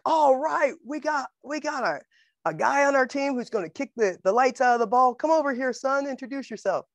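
high-pitched, booming coach impersonation; acted-out coach calling a player over; excited coach. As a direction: hail your new star player across the field. Coach hails the new kicker.

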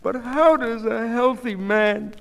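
high, slow, distressed question; bewildered wife. A narrator's reading. Goldie questioning the doctor about her husband, bewildered. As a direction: plead for an explanation, drawn out.

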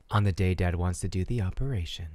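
usual speaking voice

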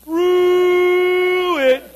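high, loud, stretched through; long drawn-out wail. Shown as a demonstration. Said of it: "So let's practice it".

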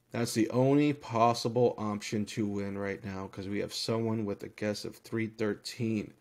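usual speaking voice

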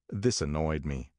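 ordinary delivery, nothing marked

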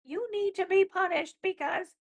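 high, cackling witch voice; Wicked Witch voice. Acted. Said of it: "I love your Wicked Witch voice".

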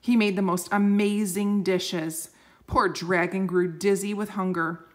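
ordinary delivery

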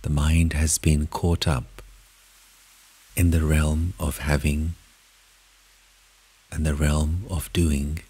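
soft, unhurried meditation voice with long pauses; guided meditation. Acted. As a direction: lead them gently inward, phrase by phrase. Leads a guided meditation in first person.

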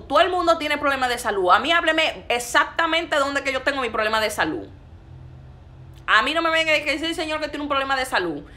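high, loud indignant rebuttal; indignant preaching. Real, not acted. Preacher demanding exact details from would-be prophets, indignant. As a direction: fire back at the vague prophet, loud and high.

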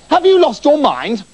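high, loud incredulous cry; exclaimed in alarm. Acted. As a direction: demand it in shocked disbelief. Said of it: "the other doctors exclaimed".

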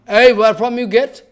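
loud, sharp scolding question; angry rebuke. Retold or imitated. Mahaprabhu scolding Govinda for the hidden Harithaki, loud and angry. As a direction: demand an answer like a stern master.

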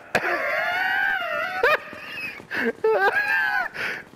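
long, high shrieking laugh; real laughter. Real, not acted. Gamer taunting a hated rival, laughing hard. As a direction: break into a helpless high laughing fit.

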